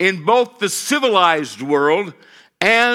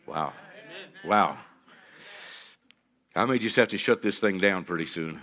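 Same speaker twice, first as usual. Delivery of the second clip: usual speaking voice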